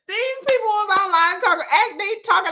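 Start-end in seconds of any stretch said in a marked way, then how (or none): none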